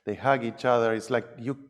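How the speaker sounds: usual speaking voice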